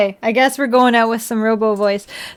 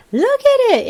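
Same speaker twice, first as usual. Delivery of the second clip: high proud squeal; excited; 13 semitones higher, 3 dB louder than usual. Real, not acted. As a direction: show it off delighted. Showing off pretty build.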